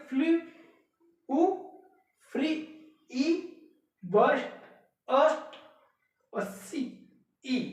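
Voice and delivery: "फ्लू", pause, then its vowel "ऊ" alone; each word, then its ending: "फ्री", "ई"; teacher echoing "बर्स्ट" as just "अस्ट"; isolating rhyme sounds. Shown as a demonstration. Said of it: "उसमें जो vowel जो sound आएगा".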